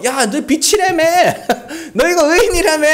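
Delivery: high-pitched jeer with a laugh; needling, laughing mockery; mocking sarcasm. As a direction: taunt them about their own boasts. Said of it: "비아냥거리는 거예요".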